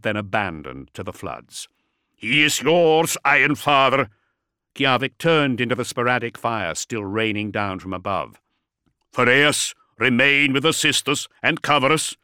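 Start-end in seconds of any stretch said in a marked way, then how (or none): 2.1-4.1 battle shout. Acted. Warrior shouts across battle. 9.1-12.2 loud, firm order over gunfire; commanding order. Acted. Commander gives orders in battle.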